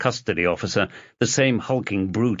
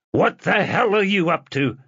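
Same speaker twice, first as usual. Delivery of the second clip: angry; 10 semitones higher, 3 dB louder than usual. A narrator's reading. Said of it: "Doherty's head was shaking with rage".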